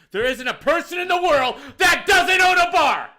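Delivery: sarcastic rant rising to a high yell; exasperated shout. Retold or imitated. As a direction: shout the absurd claim in exasperation. Sarcastic outburst about customer's claim.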